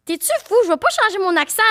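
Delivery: high-pitched mock outrage; rage mimée. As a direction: refuse indignantly, pitched well up. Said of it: "de rager". (rejouer une indignation, voix haut perchée)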